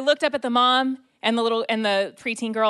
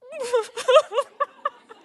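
high, overwhelmed laugh breaking into tears; crying laugh; 4 dB louder than usual. Real, not acted. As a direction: laugh through sudden tears. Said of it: "I'm, like, losing it".

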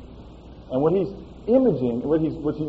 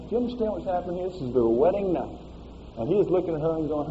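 normal talking voice

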